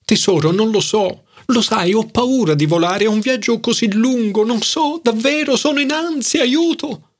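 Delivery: anxious rising pitch. A narrator's reading. A nervous friend afraid of flying, rising to panicked high notes. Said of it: "Iniziò ad alzare la voce fino a raggiungere i suoi soliti acuti di agitazione".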